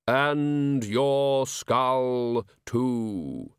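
very slow, solemn Death; drawn-out, hollow reaper voice; grim reaper voice. Acted. Death replies.

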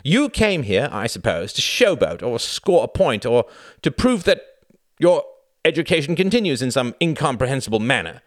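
high, loud indignant tirade; rage. A narrator's reading. Reginald berating Tom for hijacking his evening, enraged. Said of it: "the rage in his brother's".

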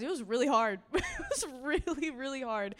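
a laugh breaking into the words; laughing admission. Real, not acted. Speaker admits hardship to the congregation, laughing.

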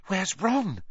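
high, scared hushed question; terrified whisper. A narrator's reading. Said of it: "she whispered in a terrified voice".

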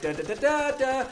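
high staccato "da da" theme; imitated film music. Storyteller mimics the music as the mummy revives, high da-da theme. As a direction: mimic an exciting soundtrack in high syllables. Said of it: "The music is like".

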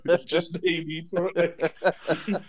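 giggling through the words; laughing it off, flustered. Real, not acted. Flustered laughing about Scarlett Johansson.

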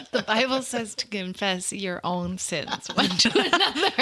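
laughing through the words; giggling as she confesses. Real, not acted.

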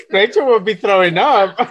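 slow words squeezed through laughter; laughing. Real, not acted. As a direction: say it while cracking up. Group cracking up over story.

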